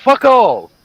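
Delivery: high mock-British exclamation; imitated British accent, high and sudden; [name], British accent. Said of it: "I was adding the accent".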